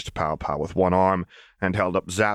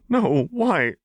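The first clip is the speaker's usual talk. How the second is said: high, nervous questioning; nervous servant; wary no; 11 semitones higher than usual. A narrator's reading. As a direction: answer with dread. Chuck wary.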